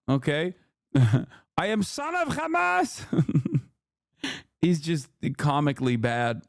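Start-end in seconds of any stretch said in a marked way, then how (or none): 1.6-3.0 high-pitched, hammed-up Arabic-accented impression; mock Arabic accent. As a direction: parody the guest in a thick Arabic accent. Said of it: "this is Uncle Mahmoud".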